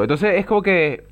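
normal talking voice

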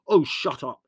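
loud, high, exasperated snap; snapping. A narrator's reading. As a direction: cut him off irritably. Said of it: "snapped Leslie".

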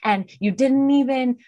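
put-on high-pitched, exasperated rant at a drunk partner; mock-furious tirade at her boyfriend; angry outburst. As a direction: reenact losing it at him, voice pushed up high. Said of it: "I was like".

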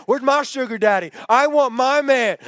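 high, loud mock-jealous complaint; indignant demand. Single bridesmaid envies married friends, indignant demand.

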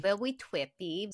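twippy with a w for the r, cutesy; baby-talk twippy. Pretend. Echoing the co-host's trippy, cutesy baby lisp. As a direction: say twippy like a toddler lisping. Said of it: "echoing super trippy".

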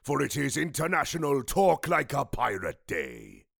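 growly pirate announcer. Acted. Podcast intro done pirate style.

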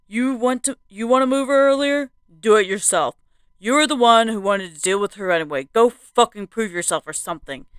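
loud, angry man; angry demand, fast. A narrator's reading. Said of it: "he demanded".

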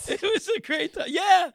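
high-pitched through laughter; helplessly amused at your own story; laughing. Real, not acted. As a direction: squeeze it out while laughing. Laughing over own gross story.

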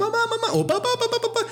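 put-on child screaming for parents. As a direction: cry out for mom and dad like a scared kid. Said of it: "Así como".

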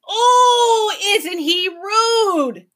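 very high, loud, slow mock shriek; screaming creature. A narrator's reading. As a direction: shriek with delight at the rude boy. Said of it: "he screamed".

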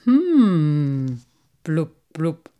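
long, loud humming then bubbly blubs; savouring fish murmur of approval; amused carp. A narrator's reading. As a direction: hum with relish, then pop the bubbles. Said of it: "schmunzelte Cyprinius".

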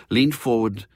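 plain reading voice

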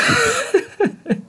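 risada alta e aguda; gargalhada aguda. Real, not acted. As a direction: solte uma gargalhada.

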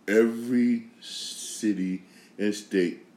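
measured, spaced-out words for a big prediction; slow, weighty emphasis. Real, not acted. Host predicting a political turn, slow and weighty. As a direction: draw out each word slowly.

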